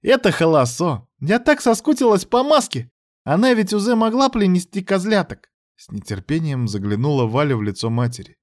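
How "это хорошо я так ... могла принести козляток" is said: put-on high, excited child voice; eager child with a lisp; lisping little girl. A narrator's reading.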